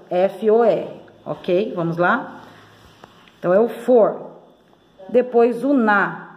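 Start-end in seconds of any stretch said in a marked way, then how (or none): none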